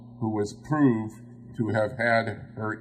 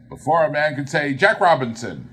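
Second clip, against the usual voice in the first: louder, quick and hurried; brisk cheerful order; 4 semitones higher, 6 dB louder, faster than usual. A narrator's reading. Fezziwig hurrying his apprentices, brisk loud order. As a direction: rush it out, urging the lads on. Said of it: "with a sharp clap of his hands".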